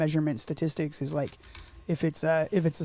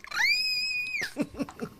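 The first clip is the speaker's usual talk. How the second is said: very high squealing noise; funny squeal noise; 5 dB quieter than usual. Shown as a demonstration. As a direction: squeak like a toy. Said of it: "I know how to make a funny noise".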